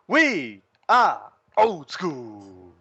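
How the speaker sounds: slow, low drawn-out announcer voice; ringmaster. Acted. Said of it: "let's say it right".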